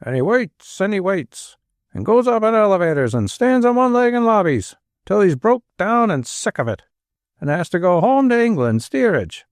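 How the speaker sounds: high, fast, breaking tirade; furious Manchester rant. A narrator's reading. Father raging to Anne about millionaires, voice breaking. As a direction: pour out the grievance until the voice cracks. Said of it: "his voice break roughly".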